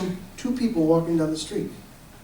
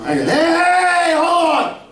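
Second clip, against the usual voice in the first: high, loud slow yell to stop them; calling out; 12 semitones higher, 5 dB louder, slower than usual. Retold or imitated. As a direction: shout after them to stop. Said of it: "I go".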